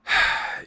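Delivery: long tired sigh; weary sigh. Acted. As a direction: let out a weary breath. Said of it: "he just kinda sighs".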